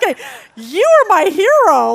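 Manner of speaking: loud, high-pitched excited cheer; gushing admiration. Retold or imitated. Teacher reacting to the girl who witnessed at the mall, gushing. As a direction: burst out with delighted hero worship.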